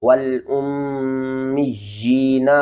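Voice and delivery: والامیین with the long ی held for one alif; stretched ya. Shown as a demonstration. Teacher shows students the stretched ی in والامیین. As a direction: pull the ی of والامیین long and slow. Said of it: "ایک الف کے برابر".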